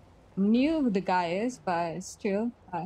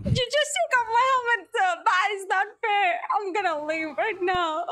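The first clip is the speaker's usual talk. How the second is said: mock whiny crying; 9 semitones higher than usual. Helmet taken, mock crying protest.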